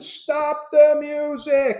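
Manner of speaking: slow, high furious yell; angry yell. Retold or imitated. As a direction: yell to stop everything, furious. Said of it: "he starts yelling".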